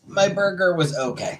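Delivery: put-on high, loud New York accent. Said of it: "that my New York accent?".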